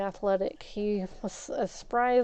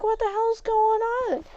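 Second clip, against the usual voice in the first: much higher, startled neighbor voice; neighbor lady voice; 11 semitones higher, 3 dB louder than usual. Retold or imitated. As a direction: play the alarmed neighbor. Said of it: "She's like".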